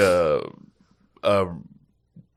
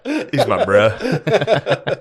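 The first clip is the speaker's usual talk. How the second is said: laughing; 6 semitones higher, much slower than usual. Retold or imitated. Slow laughing retake.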